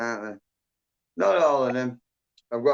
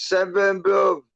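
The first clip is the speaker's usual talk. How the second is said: excited; 7 semitones higher, 5 dB louder, slower than usual. Real, not acted. Excited greeting on stream.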